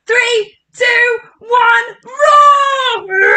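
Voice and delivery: put-on cheering the kids into their biggest roar; countdown to a roar. Shown as a demonstration. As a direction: build up and let out a big roar. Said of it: "Biggest roar".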